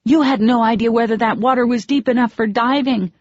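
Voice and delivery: high, loud upset rebuke; angry scolding. Acted. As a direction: tell him off, still shaking. Scolding him after his dive.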